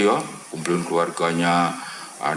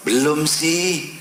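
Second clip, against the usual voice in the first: high, slow, stretched retold answer; lightly voiced hesitant driver; drawn-out reply; 10 semitones higher, 3 dB louder, much slower than usual. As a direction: draw the answer out in a raised pitch.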